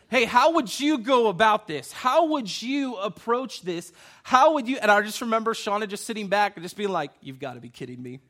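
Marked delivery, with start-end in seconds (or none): 0.1-4.8 higher, louder imitation of a pleading mom; hammed-up urgent asking; mock eager questioning. Mom eagerly asks him for advice. 7.2-8.2 low, soft imitation of an incredulous wife; understated retold incredulity; deadpan disbelief. Wife sits back in disbelief.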